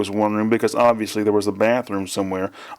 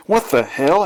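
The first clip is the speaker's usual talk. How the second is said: high mock scream, only slightly louder; understated scream of shock, pitched up; startled scream; 6 semitones higher, 3 dB louder than usual. A narrator's reading. Startled woman at sudden ghost, mild high scream. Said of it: "Angelica screamed".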